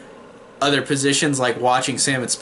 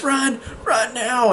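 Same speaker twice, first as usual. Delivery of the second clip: retold scream; 10 semitones higher, slower than usual. Tina screams for someone to run, mock scream. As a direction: imitate a desperate scream at restrained volume. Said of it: "she screams".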